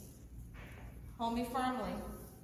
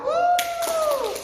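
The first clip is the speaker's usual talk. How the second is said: high, loud woo; excited whoop; 12 dB louder than usual. Real, not acted. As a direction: whoop with joy. Teacher cheers after good job.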